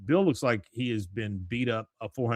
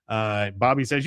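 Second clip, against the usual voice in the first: ordinary talk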